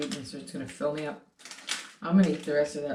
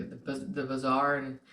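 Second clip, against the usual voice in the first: usual speaking voice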